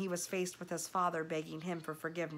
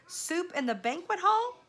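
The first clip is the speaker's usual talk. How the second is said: much higher, louder disbelief; can't believe it; amazed; 12 semitones higher, 7 dB louder than usual. Acted. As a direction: rat astonished at the offer. Roscaro amazed.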